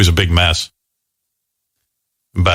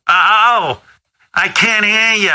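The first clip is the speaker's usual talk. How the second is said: mom voice; 10 semitones higher, much slower than usual. Retold or imitated. Mother voiced in phone story.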